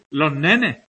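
Los nenes with the final s dropped, lo nene; aspirated s. Shown as a demonstration. Teacher showing Málaga s-aspiration to students. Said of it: "La aspiración de la S final".

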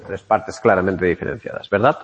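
quoting her own catchphrase with a wink; self-mocking catchphrase. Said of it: "aquí nos corta mi tan usada frase de".

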